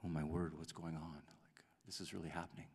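hushed, breathless whisper of shock; stunned whisper. Retold or imitated. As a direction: whisper it like you can't believe it. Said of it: "And then I was like".

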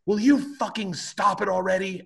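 high, nagging woman's voice; angry neighbor. Retold or imitated. Neighbor scolding a drunk skateboarder, high nagging mimicry. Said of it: "this woman comes out and goes".

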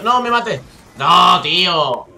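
loud, dragged-out "no" of defeat; frustrated outcry. Real, not acted. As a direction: yell it as you get killed. Dies in game, cries out.

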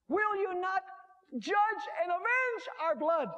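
high, slow cry of appeal. Acted. Martyrs plead with God for justice, crying out. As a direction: call out high and drawn out. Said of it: "they're crying out, they're saying".

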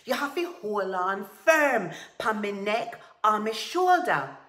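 loud, impatient instruction; exasperated order. A narrator's reading. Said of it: "Tiger hissed".

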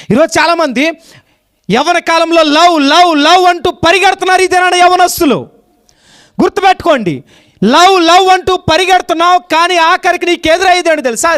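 high, loud warning from the pulpit; full-force preaching. Real, not acted. Preacher warning young people chasing love, full-force preaching. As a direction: cry out a warning to the crowd.

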